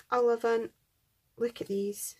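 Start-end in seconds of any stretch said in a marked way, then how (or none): 1.4-2.2 whisper. Real, not acted. Admiring die cuts.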